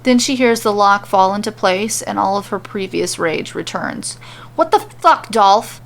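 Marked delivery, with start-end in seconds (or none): 4.5-5.8 furious shout at the top of her lungs; screaming. A narrator's reading. Mandy screaming at Dolph through locked doors, furious. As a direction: yell it, enraged. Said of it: "is what she ends up screaming".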